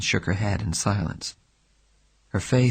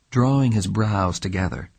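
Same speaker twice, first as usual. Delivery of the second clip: usual speaking voice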